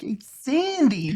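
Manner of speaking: high, drawn-out greaser call; teasing Danny Zuko. Retold or imitated. Uncle following niece around, high teasing Danny Zuko call. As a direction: stretch the name out to pester. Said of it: "I just kept following her around".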